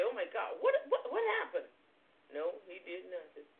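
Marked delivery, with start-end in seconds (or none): 0.0-1.7 mock shocked cry. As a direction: voice jumping way up in disbelief, with a laugh. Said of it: "Didn't he say".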